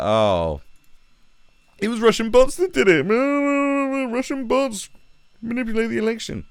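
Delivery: very high, silly mocking voice; mocking outcry. Ranter mimicking believers in the Russian bot story, high mocking squawk. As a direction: squawk it like a gullible panicker.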